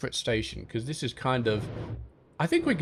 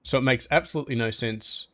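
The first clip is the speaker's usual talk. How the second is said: ordinary delivery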